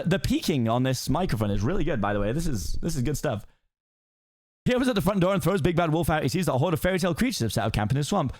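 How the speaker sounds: ordinary talk, nothing marked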